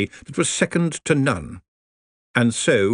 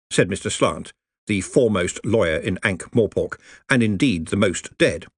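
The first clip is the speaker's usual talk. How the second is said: ordinary delivery, nothing marked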